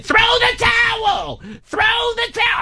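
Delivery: high, slow screaming plea to throw the towel; screaming wife. Retold or imitated. Wife screaming at ringside for them to throw the towel. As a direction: shriek it out high and drawn. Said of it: "and she's screaming".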